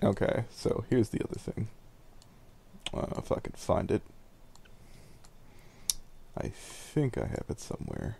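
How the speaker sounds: half to himself while searching files; soft search mutter. Real, not acted.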